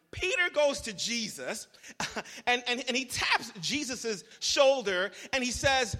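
ordinary talk, nothing marked

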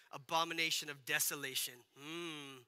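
low, soft, ominous YouTube-prophet voice; mock doomsday prophet. Mocking a YouTube prophet, low and ominous. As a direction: intone it like a fake end-times prophet, then a low hum. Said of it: "pluck them out of their context and be like".

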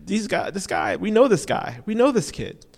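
mock-incredulous townsfolk; lightly voiced amazed villagers; astonished crowd. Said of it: "this is what they're saying".

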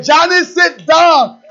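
put-on high, loud, drawn-out order to a child; parent's scolding shout. Parent to lively child Johnny kicking things over, scolding shout. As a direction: holler it slowly at an unruly child. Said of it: "you have to say".

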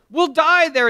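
high, loud fearful whine; mock panicky protest; whiny Lot. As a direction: wail the excuse. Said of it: "And Lot says".

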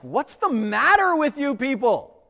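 high, slow, incredulous scolding; what's wrong with you people, disbelief in the voice; exasperated Paul. Retold or imitated. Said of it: "he has one overarching message".

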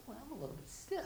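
high, soft wince of a complaint; mock stiff groan. Churchgoer waking stiff on Tuesday, soft wincing complaint. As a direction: complain softly while stiff.

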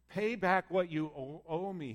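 mildly angry, drawn-out demand; measured, understated anger as a story character. A narrator's reading. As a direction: demand it slowly, with a touch of anger. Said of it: "and he was angry".

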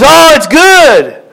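high, slow, sing-song friend's reply; chirpy friend. Retold or imitated. Friend brightly reacting to the lady's news, high and slow. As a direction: play the cheery friend, drawn out. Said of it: "And the lady says".

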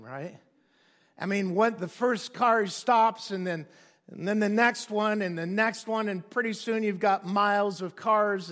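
ordinary talk

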